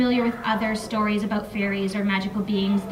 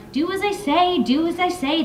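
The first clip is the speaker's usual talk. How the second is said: mocking echo; 6 semitones higher than usual. Jackal mocking his brother's order.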